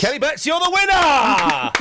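loud, high, drawn-out game-show yell; winner announcement. Pretend. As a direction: shout the winner like a big contest result. Announcing the mock contest winner.

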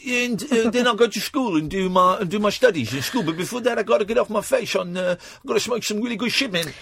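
high-pitched mock Dutch stoner accent; hammed-up chompy Dutch accent; crazy Dutch accent. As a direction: ramble as a Dutch caricature, chewing words in the back teeth. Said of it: "I'm a crazy Dutch guy".

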